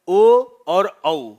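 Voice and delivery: ओ and औ stretched slowly and loudly with rounded lips; slow, loud demo of rounded ओ and औ; rounded vowel demo. Shown as a demonstration. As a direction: draw out ओ and औ loudly with a full lip round. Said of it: "मुख वृत्ताकार होता है".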